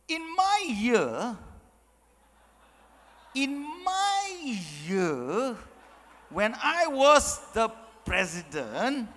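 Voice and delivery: hugely stretched, grandiose boast; pompous past president. Acted. Past president boasting of his year, absurdly pompous. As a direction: draw every word out with ridiculous self-importance. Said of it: "that's how ridiculous it sounds".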